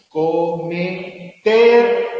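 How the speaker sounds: teacher demonstrating stress in cometer; word stress demo. Shown as a demonstration. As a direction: punch out co-me-TER. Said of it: "pues diríamos".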